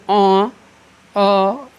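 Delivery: आ rounded into a held "aw"; drawn-out open "aw" for आ; half-moon vowel demo. Shown as a demonstration. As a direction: say आ halfway between aa and o. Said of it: "जब हम इसका उच्चारण करते हैं".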